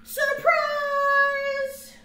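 high, loud sung-out surprise; shouted surprise. Acted. Friends jumping out at the birthday party, loud sung shout. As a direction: yell it like a surprise party crowd.

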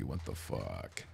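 low, slow, dragged out; deep mock voice. Reacting to weird PC.

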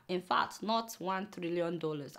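hushed correction. Acted. Aside correcting the figure.

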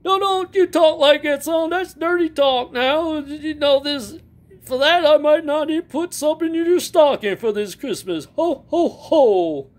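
high, hammed-up jolly Santa. Animatronic Santa greets the fans in a high jolly voice. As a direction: boom out ho-ho-ho like a toy Santa.

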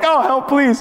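high, playful squeal of help; giggly mock cry for help, pitched high; laughing call for help. Shown as a demonstration. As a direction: call out like losing a tickle fight. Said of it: "you're in a tickle fight and you're losing".

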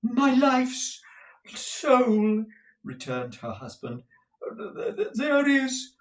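high, loud, gushing dandy husband; a flamboyant husband fussing over money; Mantalini's voice. Acted. Mantalini asks his wife about the cash, high and gushing.

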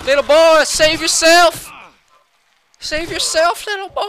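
high, loud strained cry; mock-desperate father. Player as dad calling to little boy, mock desperation. Game dad calls to his son.

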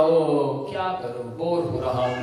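low, sagging bored mumble; low-pitched bored complaint. Acted. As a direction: act out listless boredom, pitch dropping. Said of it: "bore इसलिए हो रहे हैं".

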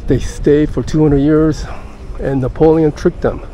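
usual speaking voice

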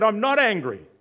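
high, very loud acted outburst; angry shouted denial. Patient losing it at his psychiatrist, angry shouted denial. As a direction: yell it in mock exasperation.

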